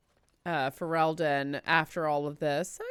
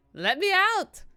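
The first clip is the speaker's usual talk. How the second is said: shrill-high louder repeat of the yell; comic high-pitched cry to escape; higher mock shout; 11 semitones higher, 6 dB louder, slower than usual. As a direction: pitch the yell way up in exaggerated desperation.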